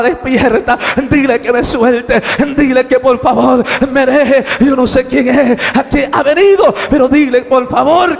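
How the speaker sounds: loud, frantic begging, rising in pitch; yelled pleading. Acted. Man shouting to wake his snoring companion, yelled pleading. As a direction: yell to wake him and beg. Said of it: "Y yo le gritaba".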